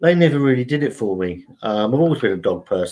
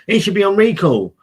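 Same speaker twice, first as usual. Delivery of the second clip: mock yell; 9 semitones higher than usual. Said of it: "this bloke's still shouting".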